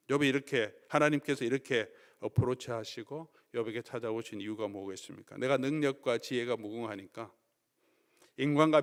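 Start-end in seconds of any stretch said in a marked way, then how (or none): none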